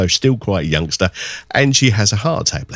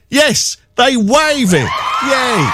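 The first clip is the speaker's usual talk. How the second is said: radio host whooping at a happy ending; cheering; 13 semitones higher, slower than usual. Real, not acted.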